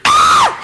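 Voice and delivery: high, loud startled shriek; mother's scream. Retold or imitated. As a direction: shriek in sudden fright. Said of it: "And my mother goes".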